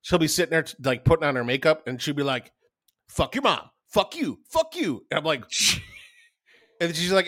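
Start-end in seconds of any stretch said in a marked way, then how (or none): 3.1-5.0 imitated vocal tics. Retelling a Tourette's makeup video, imitated sudden tics. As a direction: imitate sudden involuntary swearing, each burst separate. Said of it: "following this girl with Tourette's lately".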